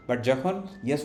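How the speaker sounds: ordinary talk, nothing marked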